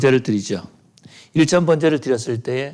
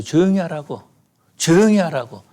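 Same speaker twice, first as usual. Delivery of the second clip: higher, louder scolding that builds; hushing rebuke; 6 semitones higher, 4 dB louder than usual. Retold or imitated. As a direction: shush him sharply, then snap. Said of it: "이렇게 말씀할지 모릅니다".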